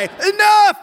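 high, drawn-out scream at the end of patience. Retold or imitated. Father breaking up his sons' squabble, screaming. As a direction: explode as if you can't take it any longer. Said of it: "I finally scream".

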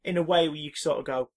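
ordinary talk, nothing marked

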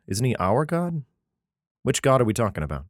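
ordinary delivery

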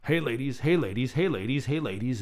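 sing-song come-on from the trees; playful hint of a serenading insect; katydid voice. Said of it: "is what they're saying".

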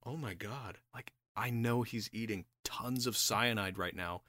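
low, very soft stunned aside; hushed disbelief. Acted. Yusupov watching his poisoned guest keep eating, hushed disbelief. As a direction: think it aloud under your breath, appalled. Acted inner thought of stunned onlooker.

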